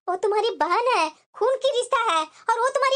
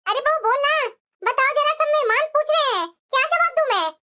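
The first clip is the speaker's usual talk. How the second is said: demanding scold; 4 semitones higher than usual. Acted. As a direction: press her hard for an answer in front of guests. Mother-in-law publicly demanding answers. (सास बहू से ऊँची आवाज़ में जवाब माँगती है)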